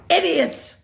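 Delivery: high, sharp angry shout; furious yell. A narrator's reading. Cruella yells at her bungling henchmen. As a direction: explode at the fools. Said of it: "she yelled".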